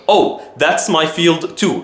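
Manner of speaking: ordinary delivery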